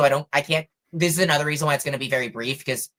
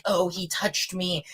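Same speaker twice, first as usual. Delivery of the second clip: softly voiced, higher mock outcry; a stifled imitation of a dramatic scream; 4 semitones higher, 5 dB quieter, slower than usual. Accuser falls down claiming assault, mock scream kept soft. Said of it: "dramatically screams".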